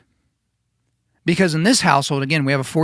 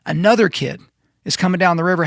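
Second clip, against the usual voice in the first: ordinary delivery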